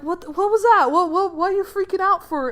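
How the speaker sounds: high, loud confused questioning; acted bewildered alarm. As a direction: play a friend baffled by the panic. Friend bewildered by Max's panic.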